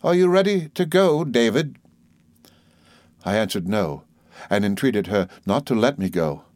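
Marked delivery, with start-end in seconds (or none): 0.0-1.8 brisk aunt. Acted. Aunt voiced in story.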